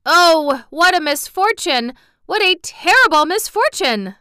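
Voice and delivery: high, loud lament; wailing. Acted. Mister Cinnamon Bun laments the loss, high wailing. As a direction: mourn the disaster with a wail. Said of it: "wailed Mister Cinnamon Bun".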